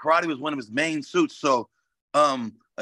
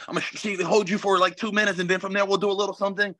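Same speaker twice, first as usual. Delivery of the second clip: fast, higher-pitched mock game plan; dismissive imitation, quick and raised; mocking cautious fighters; 5 semitones higher, faster than usual. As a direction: rattle off a timid plan mockingly.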